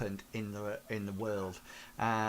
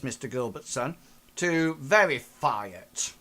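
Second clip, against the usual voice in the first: higher, louder, stern businessman's voice; stern Mister Dreyfus; 4 semitones higher, 6 dB louder than usual. Acted. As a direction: lay down the demand like an irritated boss. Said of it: "Mister Gilbertson and says".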